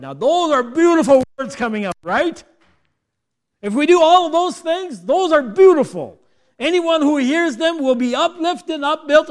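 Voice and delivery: loud, high preacher's delivery; impassioned preaching. Acted. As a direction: drive it home to the congregation at full volume. Preaches at full force.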